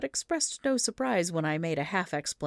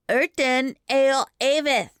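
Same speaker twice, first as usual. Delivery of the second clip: put-on small boy's impatient, louder repeat; impatient child; 8 semitones higher, 8 dB louder than usual. A narrator's reading. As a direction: say it like they should know by now. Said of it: "with some impatience".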